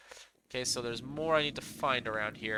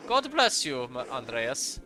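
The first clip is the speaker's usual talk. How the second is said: put-on German accent; 2 semitones lower, slower than usual. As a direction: greet him in a German accent. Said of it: "I gave him a German accent".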